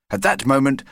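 plain reading voice

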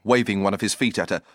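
usual speaking voice

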